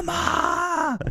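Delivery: high, wailing mock cry for mama; imitating crying for mama. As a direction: wail it like a terrified kid on a ride. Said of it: "starts crying for his mama".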